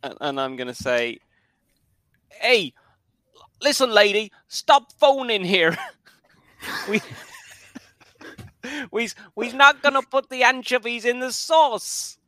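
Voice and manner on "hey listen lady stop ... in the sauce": loud, higher Italian American pizza guy; brash Italian American accent. Acted. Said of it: "I'm Italian American".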